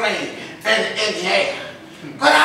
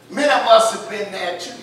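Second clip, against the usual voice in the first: ordinary delivery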